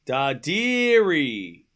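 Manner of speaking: Darderi drawn out very slowly and softly, each syllable stretched; slow drawn-out name. Shown as a demonstration. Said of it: "One of the three".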